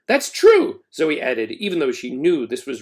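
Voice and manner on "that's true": Zoe's voice. A narrator's reading. Zoe voiced, quick to defend.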